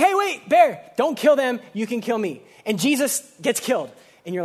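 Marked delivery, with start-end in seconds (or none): 0.0-2.4 calling out. Retold or imitated. Jesus voiced stopping the bear.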